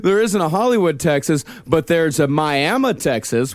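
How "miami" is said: "Miami" said as my-AM-uh; local pronunciation. Shown as a demonstration. Host naming a Texas town, "Miami" said my-AM-uh. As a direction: end "Miami" on "uh" rather than "ee". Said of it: "but is pronounced Miami".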